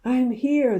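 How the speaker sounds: high, loud answer from afar; father calls back from the pit; calling out. A narrator's reading. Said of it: "the voice called out".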